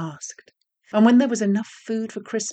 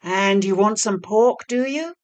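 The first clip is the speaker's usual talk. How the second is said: mocking devil; 4 semitones higher, 6 dB louder than usual. A narrator's reading. Master devil mocking small devils.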